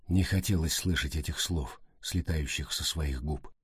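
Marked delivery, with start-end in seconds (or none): none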